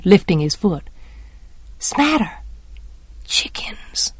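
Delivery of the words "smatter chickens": hushed, barely voiced whisper; breathed. A narrator's reading. Jem and Dill whispering in the yard, breathed. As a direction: let the words out on a breath. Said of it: "he breathed".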